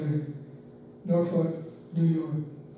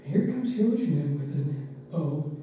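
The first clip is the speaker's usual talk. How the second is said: normal talking voice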